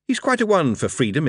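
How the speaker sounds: ordinary delivery, nothing marked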